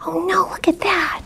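hushed spooky whisper. Acted. Reading to kids at a scary moment, whispered. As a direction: whisper it like something's outside.

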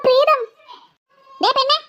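ordinary talk

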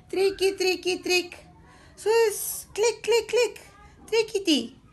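high, light clicking syllables; clattering toy sounds. A narrator's reading. Narrator mimics toys tossed on the floor, high light clatter. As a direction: chirp out little clicks and rattles.

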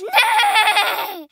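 loud "nay!" through a laugh; whinny loud and playful; laughing horse neigh. Pretend. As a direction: kid voicing the scared mare. Voicing the startled horse.

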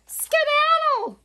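high, loud bird squawk; squawking heron. A narrator's reading. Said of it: "squawked Heron".

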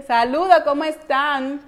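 ordinary talk, nothing marked

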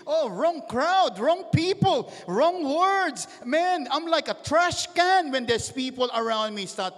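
loud, high preacher's rant; ranting outburst. Acted. Believer rejecting the wrong crowd as rubbish, loud ranting outburst. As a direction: cry out each complaint, far above normal pitch.

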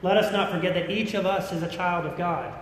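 usual speaking voice

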